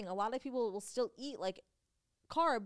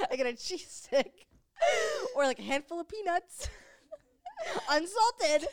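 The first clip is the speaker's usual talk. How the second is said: laughing at herself; 9 semitones higher, 8 dB louder, slower than usual. Real, not acted. Self-mocking sad diet snacks.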